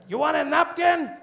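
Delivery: high, slow shouted question; taco truck vendor. Retold or imitated. Taco seller repeating to a baffled customer, drawn-out shout. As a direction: holler it with stretched words. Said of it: "He's like".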